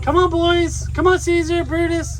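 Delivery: high, bright call to the dogs; high-pitched call to the dogs Caesar and Brutus. Real, not acted. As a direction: sing out to round up the puppies. Calling dogs.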